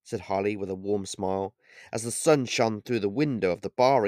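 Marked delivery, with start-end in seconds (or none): none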